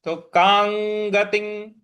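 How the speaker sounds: कांगतिं with ng sounds, slow; anusvara as ng. Shown as a demonstration. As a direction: sound कांगतिं as kaang-gating. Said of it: "अंग का ही उच्चारण ले आना है".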